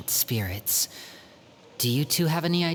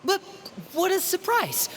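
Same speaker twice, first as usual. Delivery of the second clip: much higher, forced cheerful surprise; nervous surprise. Acted. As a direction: cover up being caught with fake delight. Caught by sister, covering up.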